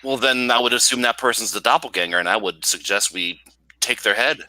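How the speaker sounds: ordinary talk, nothing marked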